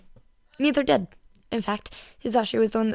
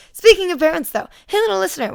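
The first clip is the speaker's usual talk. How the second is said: loud cheer; 9 semitones higher, 10 dB louder than usual. A narrator's reading. Mike's loud chatter.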